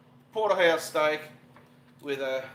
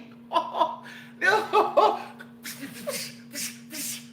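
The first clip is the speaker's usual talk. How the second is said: giddy high laughter; 13 semitones higher than usual. Real, not acted. Milestone reached.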